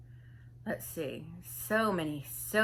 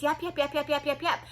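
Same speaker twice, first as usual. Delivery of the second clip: high, repetitive yap-yap chatter; mock yapping; 6 semitones higher than usual. All you heard from her, mock yapping. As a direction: yap on and on at one raised pitch. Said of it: "that's all it was".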